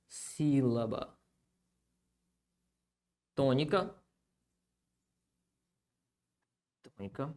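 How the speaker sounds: low, soft echo with long gaps; writing-along murmur. Real, not acted. Teacher writing a definition for students, quiet halting murmur. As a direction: repeat the words quietly at writing pace.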